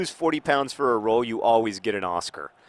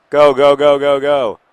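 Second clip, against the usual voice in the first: loud urgent go go; cheering on; 4 semitones higher, 12 dB louder, slower than usual. Real, not acted. Host urging his team on mid-match, loud cheer. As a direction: egg the players on, loud.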